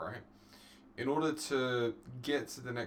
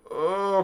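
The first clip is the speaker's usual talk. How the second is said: loud, high "oh"; loud, drawn-out exclamation of being dazzled; dazzled groan; 8 semitones higher, 11 dB louder than usual. Real, not acted. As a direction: cry out as if blinded by glare. Blinded by bright light.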